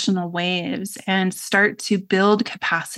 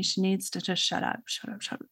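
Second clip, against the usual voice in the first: muttered under the breath, much softer; inner thought; 12 dB quieter, faster than usual. Retold or imitated. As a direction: trail down into a near-whisper, as if thinking it to yourself. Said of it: "I can remember just thinking".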